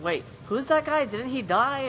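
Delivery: baffled viewer losing track; confused. Retold or imitated. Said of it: "us going".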